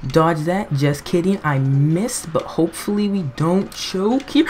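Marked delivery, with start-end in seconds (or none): none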